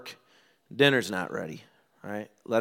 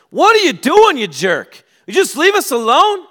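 very high, loud mock outburst; angry wife retort; 15 dB louder than usual. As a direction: yell back as the attacked wife. Acted wife snapping back at him.

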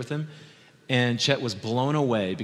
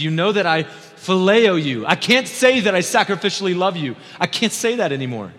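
loud, high cry, easing at the end; anguished confession; 8 semitones higher, 9 dB louder than usual. Acted. Peter answering Jesus that he can't say it, anguished shout.

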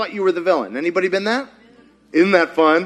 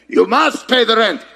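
sneering panto villain; 4 semitones higher, 3 dB louder than usual. Acted. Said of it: "This is supposed to be a mustache".

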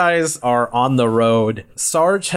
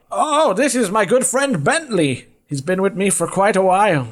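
put-on friendly old man; 9 semitones higher than usual. Old man introduces his dog, high friendly voice. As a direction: proudly introduce a companion in a high voice. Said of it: "as you approach this man".

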